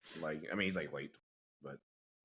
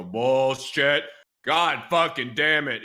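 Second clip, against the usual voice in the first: loud, raised mock bellow, words stretched; imitated screaming; 6 semitones higher, 11 dB louder, much slower than usual. Coworker making fun of the boss, screamed curses. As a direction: imitate the boss cursing at full volume. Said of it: "he'd just be screaming".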